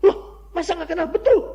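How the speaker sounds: high-pitched incredulous protest; imitated disbelief. Visitor doubting he doesn't know the pastor, mock incredulity. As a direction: act out an astonished visitor, voice jumping high.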